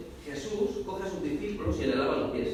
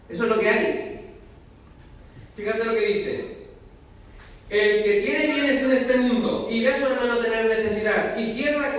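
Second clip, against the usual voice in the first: rising preacher's fervor; full-force preaching; 3 semitones higher than usual. Acted. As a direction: preach it out to the congregation.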